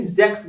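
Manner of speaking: ज़ेक्स said as German "sechs" with a z sound; not six, say ज़ेक्स; German pronunciation. Shown as a demonstration. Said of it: "सिक्स नहीं".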